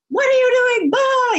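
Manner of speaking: very high-pitched screech, mimicking an angry teacher; imitated teacher's scream. Teacher screaming at a checked-out pupil in class, imitated scream. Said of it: "she screamed at me and said".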